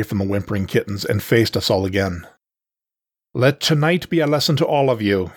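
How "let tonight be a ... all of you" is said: higher, smug proclamation; smirking. A narrator's reading. Panther warns the watching exiles, smirking superiority. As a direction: lecture them with an audible smirk. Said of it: "he said with a smirk".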